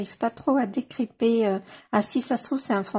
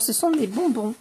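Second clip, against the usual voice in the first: ordinary talk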